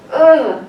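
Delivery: high, loud tense grunt; panicked "ugh". Shown as a demonstration. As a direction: act out a sudden panicky groan. Imitating panicked tension.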